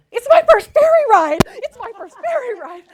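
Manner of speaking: high, loud giddy shout of delight; excited squeal. Retold or imitated. Thrilled passenger squealing on the ferry among bored commuters. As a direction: squeal it like a kid on her first boat ride.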